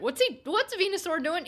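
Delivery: loud mock confusion; mock puzzled. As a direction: act baffled by the lazy monster.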